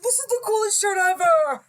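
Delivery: high-pitched, gushing kid impression; imitating excited kid. Parent showing how the child walked out this morning, all excited. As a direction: mimic a child bursting with excitement. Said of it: "All excited".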